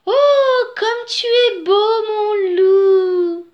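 very high, loud, drawn-out cooing; admiring she-wolves. A narrator's reading. She-wolves circle the handsome wolf, cooing admiringly. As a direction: swoon over him in a singsong falsetto. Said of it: "en murmurant à ses oreilles".

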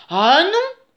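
loud, rising storybook objection; stubborn refusal. A narrator's reading. Dirty bear contradicts the she-bear, stubborn. Bears argue back and forth.

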